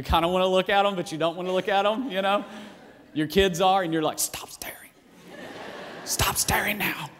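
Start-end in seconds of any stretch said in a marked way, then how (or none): 4.2-7.1 a sharp, hushed order; urgent whisper. Retold or imitated. Parent warns the kids not to stare at the yeller, whispering. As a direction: hiss it so nobody nearby hears.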